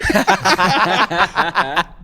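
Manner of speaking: loud, high burst of laughter; big laugh. Real, not acted. Son laughs after retelling his mom's clever answer. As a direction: let a real laugh roll out.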